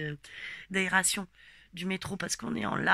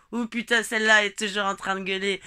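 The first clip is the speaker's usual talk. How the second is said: called out; 9 dB louder than usual. Retold or imitated. Brother calling out behind her.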